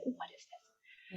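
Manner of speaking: breathy hushed whisper; barely voiced, to herself; slow hushed whisper of puzzlement. Whispered aside about the client.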